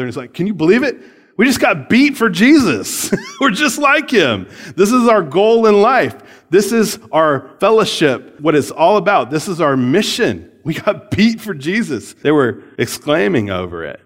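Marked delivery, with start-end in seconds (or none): 0.3-12.1 high, loud, gleeful disbelief; high-fiving excitement. Retold or imitated. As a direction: cheer like you just won. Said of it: "basically high-fiving each other".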